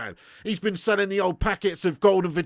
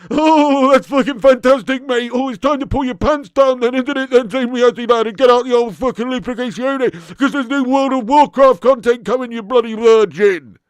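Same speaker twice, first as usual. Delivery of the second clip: higher and louder hammed-up rant; mock outburst; 7 semitones higher, 12 dB louder than usual. Streamer mock-ranting at viewer Zane over Warcraft. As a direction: blast him in mock outrage.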